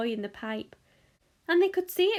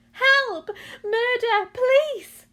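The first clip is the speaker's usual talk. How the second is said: very high, loud cries of alarm; hysterical scream; 12 semitones higher, 8 dB louder, much slower than usual. A narrator's reading. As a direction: scream for the authorities. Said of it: "screamed Missus Gloop".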